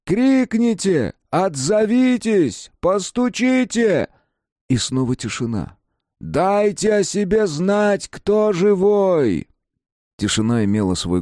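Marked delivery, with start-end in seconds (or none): 0.0-4.1 high, slow, drawn-out shouts; rescuer calling out. A narrator's reading. 6.2-9.5 long, high, slow shout; rescuer calling out. A narrator's reading.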